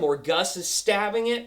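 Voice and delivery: ordinary delivery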